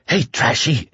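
lightly hoarse call; a touch of hoarseness in the plea. A narrator's reading. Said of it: "he said hoarsely".

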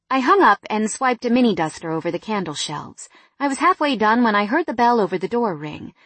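ordinary delivery

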